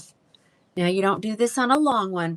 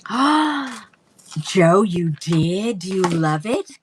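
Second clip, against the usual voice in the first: loud, slow and delighted; thrilled to hear the news; real joy, calling out to a viewer; excited greeting; 7 dB louder, much slower than usual. Real, not acted.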